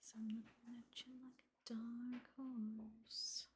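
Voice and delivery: a slow breathy whisper; whispered lyric. Real, not acted. Streamer whispering along to a song on stream. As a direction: mouth the words with only breath.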